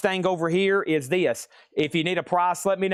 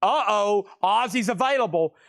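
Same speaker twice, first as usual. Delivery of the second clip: excited alarm; 4 semitones higher than usual. Real, not acted. Excited alarm over big bid.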